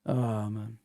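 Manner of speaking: ordinary talk, nothing marked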